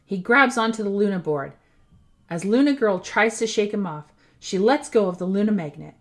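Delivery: ordinary delivery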